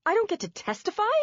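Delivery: high, loud disbelieving cry; shocked outcry at the judge; cried out. A narrator's reading. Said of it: "I cried".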